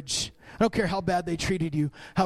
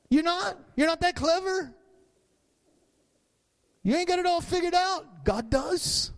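rising preaching climax; 10 semitones higher than usual. Acted. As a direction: voice climbing much higher, preached hard.

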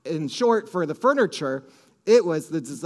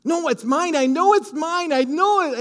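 excited insistence; 8 semitones higher, 4 dB louder than usual. Retold or imitated. Wife insisting on the furniture.

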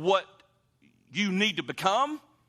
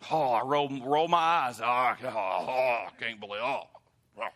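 exasperated; 5 semitones lower than usual. Retold or imitated. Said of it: "I get so put out and so frustrated".

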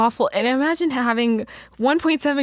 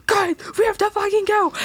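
high-pitched mock panic; high, frantic retelling; panicked urging; 11 semitones higher than usual. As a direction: urge friends to flee, voice pitched way up. Retold panic to flee robot.

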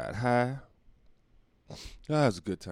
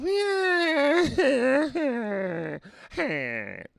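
high bleating cackle like a goat in heat; goat-like crazy laugh. Retold or imitated. Comic imitating a stranger's crazy laugh, bleating like a goat. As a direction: mimic his wild bleating laugh. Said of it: "like a goat in heat or something".

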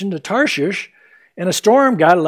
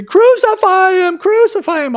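"Crucify him!" shouted much higher and louder; mob yell; 12 semitones higher, 4 dB louder than usual. Retold or imitated. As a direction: shout it like an angry crowd. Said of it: "All the crowd yells".

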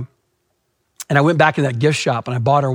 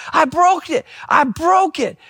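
put-on high, wailed little-girl voice; mock wail, pitched way up; imitated tearful child; 11 semitones higher, much slower than usual. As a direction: mimic a distraught child confessing, drawing out the last. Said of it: "just tears rolling down her face".

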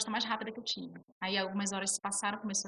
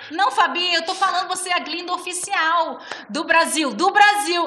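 higher, louder, insisting; insistent; 7 semitones higher, 11 dB louder than usual. Retold or imitated. Producer insisting on the phone that she is the official Glinda, loud and high. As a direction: hammer it home to her.

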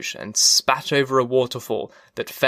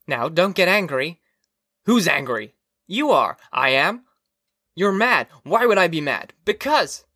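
higher-pitched, testy back-and-forth; bickering; 5 semitones higher than usual. Acted. Quarrelling relatives on the wall screens, bickering. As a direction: squabble with raised pitch.

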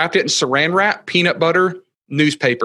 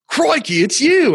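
high, excited, badly faked Australian; bad Australian accent; 12 semitones higher, 4 dB louder, slower than usual. Guest imitating Australian man recognizing him, hammed-up Australian accent. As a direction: attempt an astonished Aussie, knowingly poorly. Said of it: "I can't do an Australian accent".

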